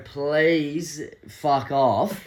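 slow and deliberate get lost; very slow dismissal of the drunk bloke; slow drawn-out brush-off. Retold or imitated. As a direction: stretch every word very slowly. Telling off the stranger.

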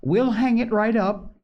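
put-on high, eager children's promise; remorseful cry. A narrator's reading. Both children promising Polly to hang up the pail, remorseful. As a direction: pitch it up like penitent children. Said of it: "cried both of the children".

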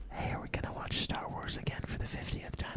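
put-on whispering girlfriend voice; ASMR girlfriend whisper. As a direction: fake sweet whisper. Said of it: "where it's like".

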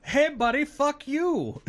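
high, loud mocking Canadian voice; angry Canadian heckler. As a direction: jeer like a rude local. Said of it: "this guy goes".

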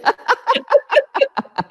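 high bursting laugh; hysterical laugh. Shown as a demonstration. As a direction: laugh like you can't stop. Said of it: "You're laughing hysterically".

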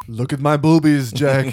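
loud hammed-up movie line; mock movie quote. Friends mocking a movie line, hammed up loud. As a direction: ham it up.

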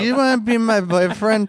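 put-on getting drunker. Voicing drunk Wobbles.

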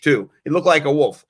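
normal talking voice

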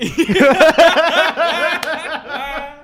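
high, loud burst of real laughter. Real, not acted. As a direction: crack up at the memory.

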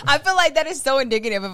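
fast, laughing through words. Real, not acted. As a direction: giggle through it quickly. Laughing while talking.

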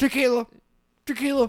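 squawky bird voice; parrot voice. Acted. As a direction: talk like a pet parrot. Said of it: "stereotypical for parrots".

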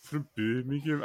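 soft, low try at Brummie; attempted Brummie accent. Shown as a demonstration. Co-host tries out a Brummie accent quietly. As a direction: echo it in a rough Brummie accent. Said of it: "Brummie accent, but it's not".

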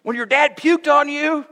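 mock a teen ribbing their dad; teasing teenage kid. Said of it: "my kids would be like".

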